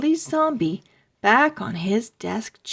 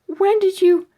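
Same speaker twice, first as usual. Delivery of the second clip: high, loud, cut off in fear; panicked question; 10 semitones higher, 8 dB louder, faster than usual. A narrator's reading. Webster asking Stink in a high, frightened rush. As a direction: ask in rising panic.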